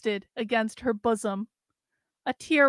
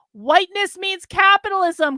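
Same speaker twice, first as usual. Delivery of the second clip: crying out; 6 semitones higher, 5 dB louder than usual. Acted. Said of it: "cried the collective".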